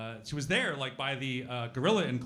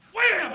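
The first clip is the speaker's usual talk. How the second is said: loud high mock bang; imitated glass slam; 10 dB louder than usual. Telling the podcast hosts how the gorilla hit the glass, shouted bang. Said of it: "He's like".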